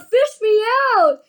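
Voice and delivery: high, loud yell. A narrator's reading. Augustus calling from the chocolate, high yell. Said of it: "he yelled".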